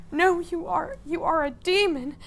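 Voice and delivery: high, loud, outraged accusation; heated accusation. Acted. Odette to Milos she thinks is corrupted, accusing. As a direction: throw the charge at him, high and loud.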